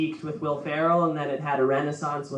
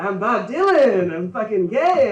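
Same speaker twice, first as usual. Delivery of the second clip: acted quote; 6 semitones higher, 4 dB louder than usual. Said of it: "He's like".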